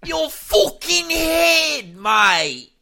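hammed-up Aussie shout, stretched out; Australian accent. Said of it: "did you turn Australian there for a second".

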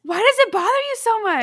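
high, loud protest; exasperated outburst. Real, not acted. Friends bickering over their first fight, exasperated. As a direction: raise the voice in exasperated challenge.

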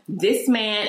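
ordinary talk, nothing marked

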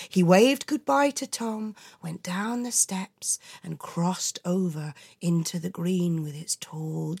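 ordinary delivery, nothing marked

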